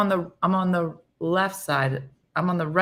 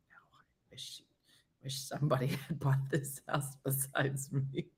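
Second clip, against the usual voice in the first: whisper; 4 semitones lower, 10 dB quieter than usual. Real, not acted. Joking aside about mortgage.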